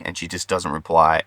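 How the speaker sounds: normal talking voice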